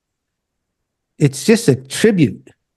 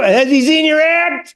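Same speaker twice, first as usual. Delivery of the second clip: raised, teasing question up high; high mock incredulous; 10 semitones higher than usual. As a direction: poke fun at him, pitched way up. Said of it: "I go".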